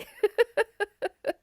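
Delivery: amused laugh at her own folly; real laugh. Real, not acted. Laughing that she's still holding on, a real laugh. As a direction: let the laugh break out warmly.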